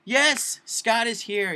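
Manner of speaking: loud, high mock-surprised shout; big hammed-up reveal of a guest; excited reveal.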